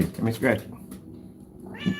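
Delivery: slow, soft and drawn out; soft coaxing. Real, not acted. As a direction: coax "Let me scratch you" gently and slowly. Coaxing cat.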